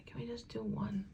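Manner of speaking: soft unvoiced whispered plea. Real, not acted. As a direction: breathe it out under your breath. Whispering while zooming camera.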